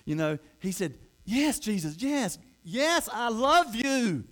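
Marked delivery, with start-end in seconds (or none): 1.2-4.2 high, drawn-out impassioned cry; from the soul. Acted. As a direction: cry out your love, building louder. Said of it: "From his, from his soul".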